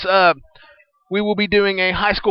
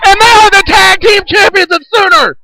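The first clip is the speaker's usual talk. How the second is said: excited shout; 10 dB louder than usual. Acted. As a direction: yell the return. Celebrating the title win.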